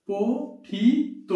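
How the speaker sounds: পঠিত drawn out slowly to pothito with a final o; পঠিত said very slowly, ending on o; slow demo word. Shown as a demonstration. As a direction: stretch পঠিত so the o ending is clear. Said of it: "তাহলে কি হবে ভাই?".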